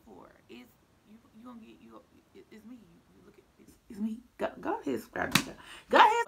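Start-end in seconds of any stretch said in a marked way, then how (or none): 2.7-3.7 voiceless whisper that builds in level. Acted. Whispered plea to non-subscribers. 3.9-6.3 much higher and louder, ending in a full yell; shout. Acted. Shouting at non-subscribers.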